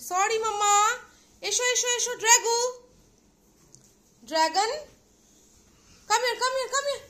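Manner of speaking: high, loud coaxing pet talk; coaxing call. Real, not acted. Owner coaxing her pet out to eat, high loud coaxing. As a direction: lure him out sweetly and loudly.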